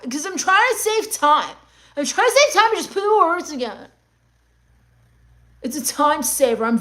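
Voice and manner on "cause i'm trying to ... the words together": mock exasperation. Exasperated answer to mimicked question.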